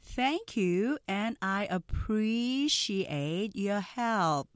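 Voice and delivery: very slow, stretched words; drawn-out, careful English demo; slow-speed model. Shown as a demonstration. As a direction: say it slowly for learners to copy. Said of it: "먼저 느린 속도 발음 듣고".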